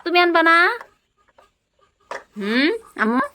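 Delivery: put-on high, bright play voice for a small child; playful toddler-talk. Mother playing house with her toddler, high coaxing voice. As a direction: coax the little one along in pretend play. Pretend scooter shopping trip.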